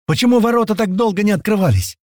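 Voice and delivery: high-pitched, urgent question; yelled question. Acted. Crowd showering questions, yelled question. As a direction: call it out high over a crowd.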